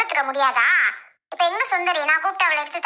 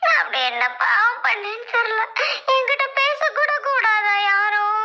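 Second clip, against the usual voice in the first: high, sobbing lament; crying out a woeful complaint; tearful wailing; 3 semitones higher than usual. Acted. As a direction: wail self-pityingly through sobs. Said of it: "இவ்ளோ அழுகலடா".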